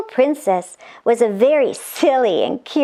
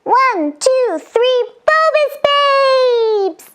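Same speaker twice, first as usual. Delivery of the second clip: high, stretched-out cheer; magical cheer; 12 semitones higher, much slower than usual. Acted. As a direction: chant it big and slow. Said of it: "magical cheers".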